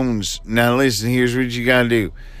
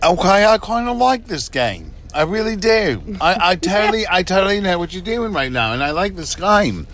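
higher-pitched put-on British accent; mock British accent; 5 semitones higher than usual. Host saying they like the game, mock British accent.

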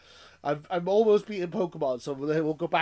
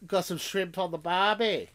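higher, drawn-out Australian catchphrase; mock Australian accent; 5 semitones higher, slower than usual. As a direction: put on a playful Aussie accent. Joking Australian barbecue catchphrase.

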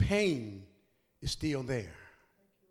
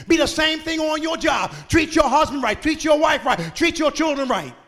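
loud, higher shouted exhortation; preaching at full force; 4 semitones higher, 7 dB louder than usual. Acted. Pastor to congregation about living the testimony, full-force preaching. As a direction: hammer each command to the pews.

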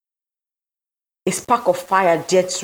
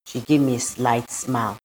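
plain reading voice

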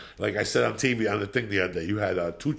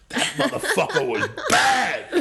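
laughing; 11 semitones higher, 6 dB louder than usual. Real, not acted.

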